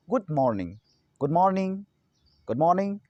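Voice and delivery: "मॉर्निंग" with an open 'maw'; correct pronunciation. Shown as a demonstration. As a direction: say "मॉर्निंग" as MAWR-ning, lips apart. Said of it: "हम लोग मॉर्निंग बोलते हैं". ("मॉर्निंग" में खुला 'मॉ', 'मो' नहीं)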